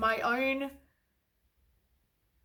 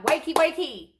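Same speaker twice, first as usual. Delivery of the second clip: universe scolding; 5 semitones higher, 4 dB louder than usual. Retold or imitated. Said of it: "The universe is like".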